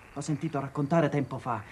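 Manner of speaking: normal talking voice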